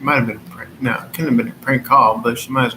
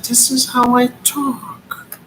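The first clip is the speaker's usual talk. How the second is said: high pitched mock of the caller; high mocking imitation; 10 semitones higher than usual. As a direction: parrot her, high and mocking. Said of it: "She's like".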